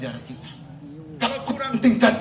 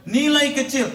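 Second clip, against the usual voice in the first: Nilai kecil pitched much higher and louder; emphatic; 10 semitones higher, 6 dB louder than usual. Shown as a demonstration. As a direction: drive the point home with force.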